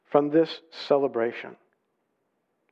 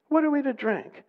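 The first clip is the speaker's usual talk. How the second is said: much higher, protesting question; grumbling complaint; 11 semitones higher than usual. Acted. Thirsty people grumble against Moses, high-pitched complaint. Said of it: "They grumbled".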